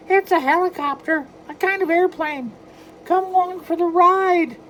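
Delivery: high, loud falsetto mouse; Mickey Mouse voice. Acted. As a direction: coax him like a cheerful cartoon mouse. Mickey explains helicopter.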